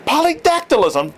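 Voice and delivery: a high, ringing shout. A narrator's reading. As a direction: shout the word in triumph. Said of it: "Torn shouted".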